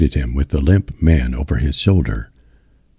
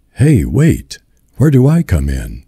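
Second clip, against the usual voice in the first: ordinary delivery, nothing marked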